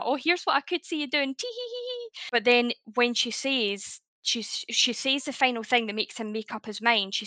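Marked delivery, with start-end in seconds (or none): 0.0-2.1 mock-sweet voice ending in a very high, soft tee hee; coy giggly girl. Host parodying a cutesy Orihime, very high soft giggle. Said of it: "rather than a".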